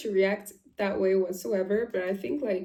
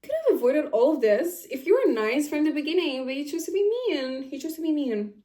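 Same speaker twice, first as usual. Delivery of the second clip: retold scolding; 5 semitones higher, 3 dB louder than usual. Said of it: "And I'm like".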